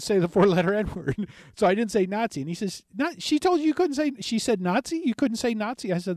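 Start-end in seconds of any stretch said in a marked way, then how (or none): 3.0-5.9 much higher, disbelieving host asking twice; incredulous. Retold or imitated. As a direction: act stunned that she banned the word. Host incredulous at screener's ban.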